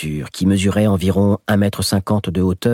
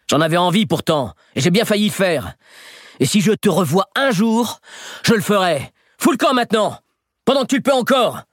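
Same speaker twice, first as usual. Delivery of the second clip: higher and higher furious threat; rising threat; 12 semitones higher than usual. Acted. Boy threatening the fleeing thief, climbing higher. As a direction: drive him off for good.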